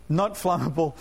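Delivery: chuckling through a clumsy word; laughing at himself. Real, not acted. Preacher laughing at his own word to church. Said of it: "That's a good try".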